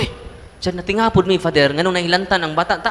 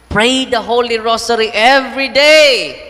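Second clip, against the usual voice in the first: slow, much louder appeal; emphatic exhortation; 6 semitones higher, 8 dB louder, slower than usual. Real, not acted. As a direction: drive it home to the parents. Preacher exhorts parents.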